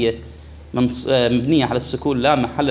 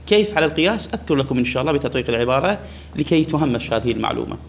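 ordinary talk, nothing marked

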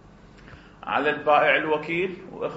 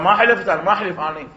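stubborn loud refusal; 5 semitones higher, 7 dB louder, faster than usual. Acted.

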